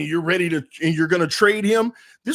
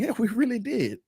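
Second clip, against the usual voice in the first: ordinary talk, nothing marked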